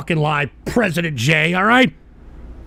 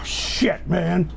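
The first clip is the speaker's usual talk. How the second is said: normal talking voice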